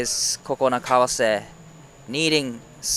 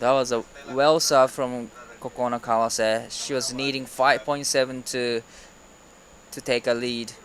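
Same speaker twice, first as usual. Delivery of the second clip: normal talking voice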